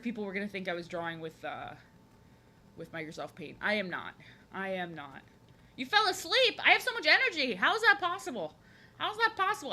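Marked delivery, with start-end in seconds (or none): 5.8-9.7 high, loud, animated disbelief; incredulous. Real, not acted. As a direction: exclaim in real amazement, pitch soaring. Amazed that a viewer fell asleep.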